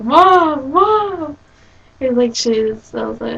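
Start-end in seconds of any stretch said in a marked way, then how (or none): 0.0-1.4 high, loud exasperated calling; hammed-up whine at mom; mock protest. Embarrassed by mom's camera, loud high protesting Mom.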